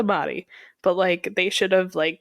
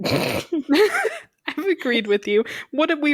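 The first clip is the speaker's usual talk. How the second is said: laughing; 7 semitones higher, slower than usual. Real, not acted. Teasing friend in argument.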